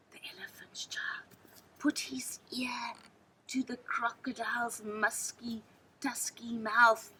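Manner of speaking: hushed, breathy storyteller whisper; whispered narration. A narrator's reading. Narrator whispering to listening children, building suspense.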